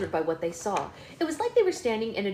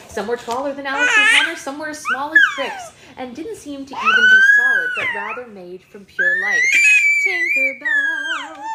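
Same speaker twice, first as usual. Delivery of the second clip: high fairy voice; 9 dB louder than usual. Acted. Reader goes into a fairy voice.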